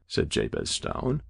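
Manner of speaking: ordinary delivery, nothing marked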